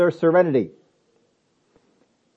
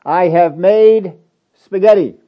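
loud, slow announcement; mom calling back; 7 dB louder, slower than usual. Acted. Unseen mother answers child's dinner question, calling back loudly. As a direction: call back across the house, each word spaced. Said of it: "and the voice says".

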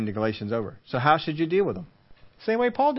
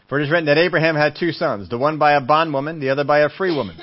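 louder, emphatic scripture reading; preached scripture; 2 semitones higher, 5 dB louder than usual. Acted. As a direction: proclaim the verse. Reads scripture at force.

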